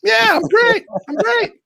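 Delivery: squeaky, very high insistence; hammed-up high cheeriness; overdone reassurance. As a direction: insist you're fine in falsetto. Overdone claim of being fine.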